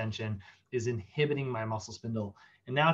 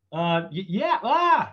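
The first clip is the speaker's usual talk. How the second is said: much higher, louder panicked cry; mock scream; 5 semitones higher, 6 dB louder, slower than usual. Tendon organ joining the spindle in a mock scream. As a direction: yelp along in alarm. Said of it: "it's, like, screaming as well".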